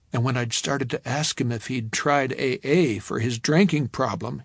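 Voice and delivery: usual speaking voice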